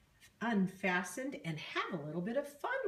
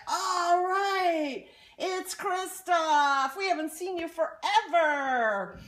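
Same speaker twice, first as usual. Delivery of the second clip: high, loud, happy troll voice; a troll thrilled to see an old friend; excited troll; 11 semitones higher, 8 dB louder, slower than usual. Acted. Troll welcomes Christoph back.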